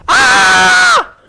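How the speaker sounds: a sudden high shout of alarm; startled high scream. Acted. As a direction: yelp as if startled by an angel. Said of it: "They were alarmed".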